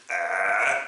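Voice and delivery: throaty noise like someone about to vomit; mock retching sound. Shown as a demonstration. Teacher showing students the vomiting letter, mock retch. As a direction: imitate the start of vomiting for the letter aïn. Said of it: "il s'apprête à vomir".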